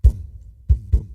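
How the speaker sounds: loud boom, a dull muddy thud with no click; beatbox boom as a sheer muddy thud; muddy thud kick. Shown as a demonstration. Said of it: "as opposed to".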